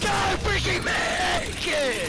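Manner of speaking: high, slow, loud pleading yell; will the jump with a scream; desperate shout. Real, not acted. Yelling at the jump.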